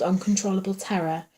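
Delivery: ordinary delivery, nothing marked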